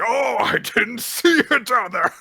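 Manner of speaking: loud, high, exaggerated taunt; hammed-up bully pretending surprise; mocking fake apology. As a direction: sneer it big, then cackle. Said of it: "when he was doing it, was like".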